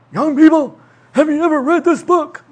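hammed-up elderly professor; old professor voice. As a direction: imitate the beloved old guest speaker. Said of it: "and he would say".

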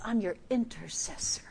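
hushed whisper as Jesus; tender whispered promise. Acted.

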